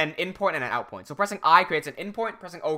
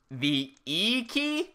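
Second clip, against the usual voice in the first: drawn-out, rising mock-baffled question; 3 semitones higher, 5 dB quieter, much slower than usual. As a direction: guess very slowly, pitch climbing in disbelief. Retelling his baffled guess at key.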